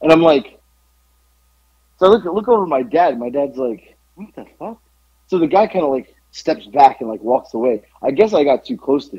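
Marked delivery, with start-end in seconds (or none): 4.2-4.8 much softer, higher retold aside; hushed disbelief. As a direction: imitate a dad's stunned, under-the-breath reaction. Dad's stunned reaction, retold.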